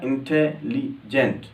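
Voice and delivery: Intelligent slowly split, इं-टे-लि-जेंट; syllable by syllable. Shown as a demonstration. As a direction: break intelligent into separate syllables with small gaps. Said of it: "इसी तरह".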